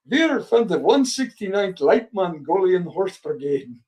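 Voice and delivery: lightly voiced German accent, proud and deadpan; a hint of German accent, playing it straight. Fake German soldier boasts to company commander in a light German accent. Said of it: "German accent".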